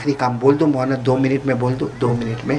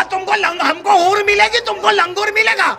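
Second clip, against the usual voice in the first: very high comic shouted taunt; angry husband. A narrator's reading. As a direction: taunt her in hammed-up anger. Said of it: "کہا".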